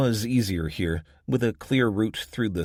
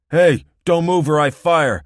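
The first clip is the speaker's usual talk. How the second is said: loud, higher barked warning; threatening shout; 9 semitones higher, 12 dB louder than usual. Acted. Armed man behind her warning the driver, loud shouted threat. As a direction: shout him down before he moves.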